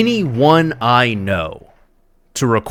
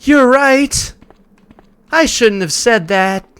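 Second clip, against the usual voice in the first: higher, louder overacted parody voice; mock bad acting; 6 dB louder, much slower than usual. Streamer mocks how the characters answer, overacted and higher. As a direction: ham up the clumsy line reading. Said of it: "And they'll be like".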